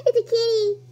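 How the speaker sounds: gasp, then high excited squeal; delighted squeal. Real, not acted. Player spotting another kitty, gasping high squeal.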